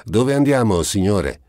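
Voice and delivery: high, loud call from the box; lightly voiced cabman calling down; coachman asking. A narrator's reading. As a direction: ask the fare over the noise. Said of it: "domandò il cocchiere".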